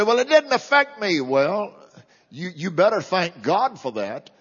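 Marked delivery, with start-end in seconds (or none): 0.0-1.2 higher-pitched dismissive objector; mock objection. Preacher mimics unconcerned churchgoer objecting, mildly mocking. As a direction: voice a listener brushing it off. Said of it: "you say".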